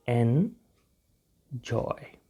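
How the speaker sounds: Enjoy very slowly, split "en... joy"; syllable by syllable. Shown as a demonstration. Teacher shows learners how to pronounce it, slow syllable by syllable. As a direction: stretch enjoy out with a gap between syllables.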